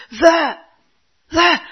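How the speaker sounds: a loud, high bark of the word; exasperated shout. Retold or imitated. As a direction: snap the word at the child, fed up. Mother snapping the word at child.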